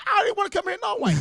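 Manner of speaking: squeaky high complaint; snapping back at Rolly, very high and indignant; high indignant protest. Retold or imitated. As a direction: whine it up high like a fed-up kid. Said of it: "I said".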